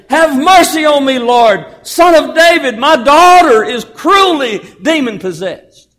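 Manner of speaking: loud, high plea to the Lord; crying out. Acted. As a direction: cry out desperately for mercy. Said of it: "began to cry out".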